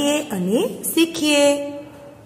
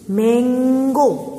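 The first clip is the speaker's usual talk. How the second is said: slow model word; much slower than usual. Shown as a demonstration. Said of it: "model word for class".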